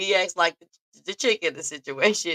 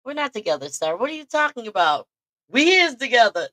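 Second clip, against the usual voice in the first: mock argument; 6 semitones higher, 4 dB louder than usual. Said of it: "it's like".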